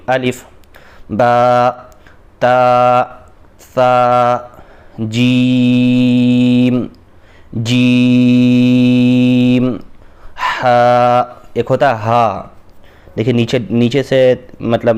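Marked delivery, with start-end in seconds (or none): 1.1-11.2 letters held long and level, louder, جیم sung out very slowly; recited letters. Shown as a demonstration. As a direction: chant each letter slowly for the class. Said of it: "پڑھیے". (حروف کو کھینچ کر، آواز سے پڑھ کر سنائیں)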